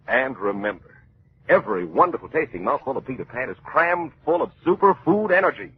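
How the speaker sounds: put-on cheery old-time radio announcer; radio ad read. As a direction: sell it brightly to the kids. Mid-show peanut butter commercial.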